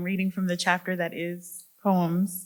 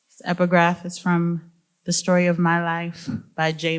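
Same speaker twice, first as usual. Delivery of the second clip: ordinary talk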